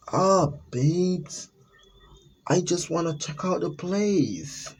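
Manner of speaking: put-on high, slow, sweet-talking girlfriend; sugary girlfriend voice. Girlfriend cooing to her boyfriend Jack before wandering off. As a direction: coo it like a clingy girlfriend.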